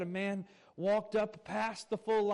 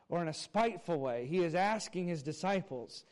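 usual speaking voice

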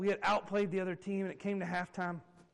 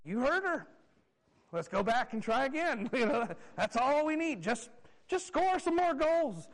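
raised, sarcastic rallying of the team; mock coach's pep talk; 6 semitones higher, 4 dB louder than usual. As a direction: send them back out with mock conviction. Coach sarcastically relays mom's advice.

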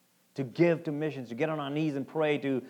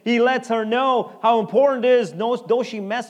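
loud, higher, driving sermon voice; preaching at full force; 8 semitones higher, 9 dB louder than usual. Acted. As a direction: drive the point home to the church. Preacher building again.

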